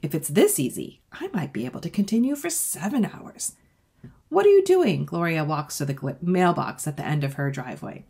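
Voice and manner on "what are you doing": high, loud neighbour calling across; a neighbour girl shouting a curious question. Acted. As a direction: call it out high and loud from down the street. Gloria calls out from mailbox.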